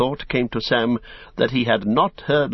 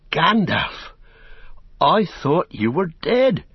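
high, slow cry of disbelief; astonished gasp; 7 semitones higher, slower than usual. A narrator's reading. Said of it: "At last he gasped".

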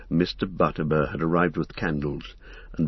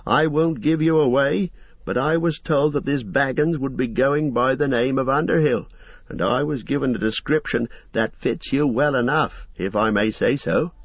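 fussy innkeeper; 7 semitones higher than usual. Acted. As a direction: chatter on like a busy, apologetic landlord. Landlord voiced in the story.

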